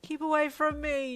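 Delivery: loud, high wail. A narrator's reading. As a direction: wail it to ward someone off. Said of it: "you wailed".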